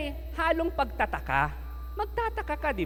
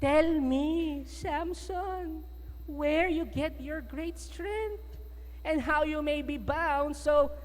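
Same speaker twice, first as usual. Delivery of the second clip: softer, slow coaxing voice as Delilah; 3 semitones higher, 6 dB quieter, slower than usual. Acted. Delilah wheedling Samson for his secret, soft and slow. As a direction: sweet-talk him slowly into telling. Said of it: "So Delilah said to Samson".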